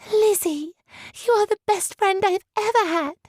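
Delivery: put-on light, high girlish voice; bright young girl's plea. A narrator's reading. Georgiana voiced by narrator.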